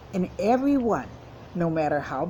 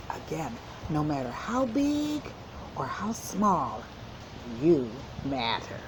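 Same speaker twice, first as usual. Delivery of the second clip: soft and gentle; 2 semitones lower, 5 dB quieter than usual. Real, not acted. Teacher to young listeners, softly and gently. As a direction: ease down softly as you wrap up. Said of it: "teacher closes the lesson gently".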